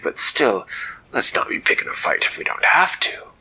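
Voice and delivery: loud salty pirate captain voice; an old sea dog amazed. Acted. Captain staring down at the lights, loud pirate voice.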